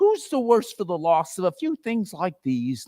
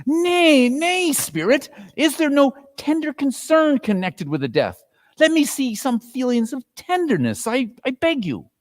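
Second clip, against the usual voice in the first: put-on higher, begging old man; pleading Scrooge; 10 semitones higher, 4 dB louder than usual. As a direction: beg the spirit for some feeling. Scrooge begs again.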